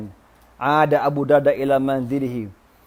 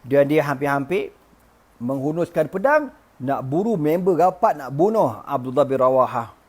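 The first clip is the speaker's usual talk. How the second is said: plain reading voice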